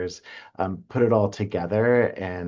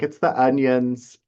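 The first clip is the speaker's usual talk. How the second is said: higher, laughing and a little slow; laughing through tears; 6 semitones higher, 4 dB louder, slower than usual. Retold or imitated. Rock admits to his sister he's crying, laughing. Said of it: "he's like".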